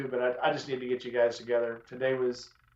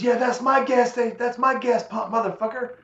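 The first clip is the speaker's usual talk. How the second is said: higher, sneering driver voice; hostile driver; 9 semitones higher, 3 dB louder than usual. Retold or imitated. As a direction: claim the pump in a pitched-up jeer.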